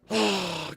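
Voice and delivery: audible exhaled sigh; weary sigh. Real, not acted. Streamer winding up the stream, sighing. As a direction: let out a sigh before signing off.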